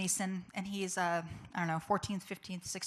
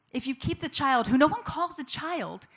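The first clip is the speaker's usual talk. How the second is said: raised, higher, emphatic oratory; loud, urgent public rebuttal; impassioned; 6 semitones higher, 7 dB louder than usual. Real, not acted. Speaker exposing lies told to women, impassioned.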